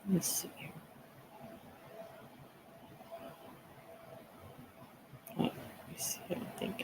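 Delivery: breathless whisper while searching; muttering while checking the screen; whispering to herself. Real, not acted. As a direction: whisper it, busy with something else. Fiddling with settings, to herself.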